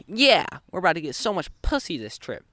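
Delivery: high-pitched, cocky mock celebration; hyped-up cheer. Two friends high-fiving over the trip, imitated excitement.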